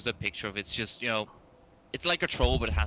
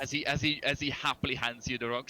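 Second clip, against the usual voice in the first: usual speaking voice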